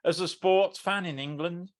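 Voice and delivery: ordinary delivery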